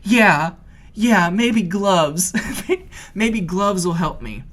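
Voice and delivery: slow, high, shaky-laughing agreement; dragging out the words in mock terror; freaking out. Scared on the ladder, grabbing at the offer of gloves. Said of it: "I'm really freaking out".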